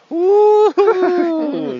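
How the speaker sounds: high whooping cry; cheering. Real, not acted. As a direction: whoop with joy. Made it out, cheering.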